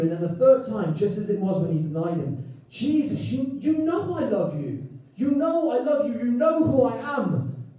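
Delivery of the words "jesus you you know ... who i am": higher, pleading reply with rising urgency; pleading Peter. Retold or imitated. Peter answering Jesus the third time, higher and pleading. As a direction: beg him to believe you love him.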